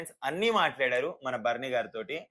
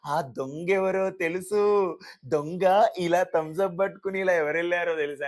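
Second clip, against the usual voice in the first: imitating Harish; 3 semitones higher, 4 dB louder than usual. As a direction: drag it out like a smug sleuth. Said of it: "అని super imitation".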